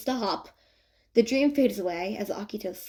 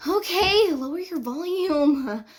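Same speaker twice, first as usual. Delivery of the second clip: exasperated; 8 semitones higher, slower than usual. A narrator's reading. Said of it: "Ann mutters, exasperatedly".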